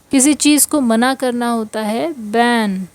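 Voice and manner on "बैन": ordinary talk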